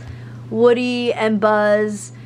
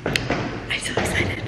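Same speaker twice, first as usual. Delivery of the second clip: whispering; 5 dB quieter, much slower than usual. Real, not acted. Whispered excitement in basement.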